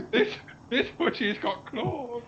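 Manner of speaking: slow, high, laughing cat villain; booming arrogant alien overlord. Pretend. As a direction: purr the threat with a giggle. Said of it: "record a thing that says".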